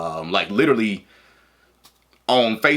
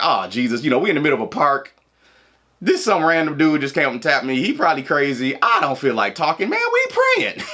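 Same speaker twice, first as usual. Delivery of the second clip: mock irritation; 6 semitones higher, faster than usual. Irritated inner thought at stranger.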